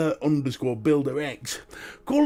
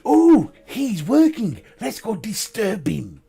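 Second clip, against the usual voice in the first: higher, slow playful voice for the dog; mischievous dog voice; 5 semitones higher, 6 dB louder, slower than usual. Pretend. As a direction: voice the pet plotting mischief. Voicing the dog's thoughts.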